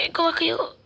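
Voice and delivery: strained and high. Acted. Struggling with heavy load.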